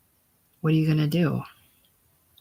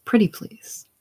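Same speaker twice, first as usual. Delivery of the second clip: coaxing plea; 6 semitones higher than usual. Acted. Coaxing him to sit.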